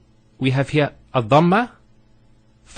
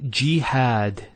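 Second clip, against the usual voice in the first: ordinary delivery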